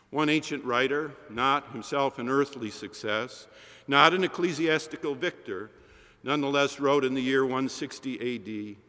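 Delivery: ordinary talk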